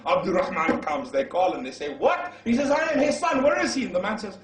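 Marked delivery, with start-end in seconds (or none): 2.0-3.9 high, loud startled shout; astonished cry. Acted. Son crying out that he is the father's son, loud and high. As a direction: burst out in disbelief.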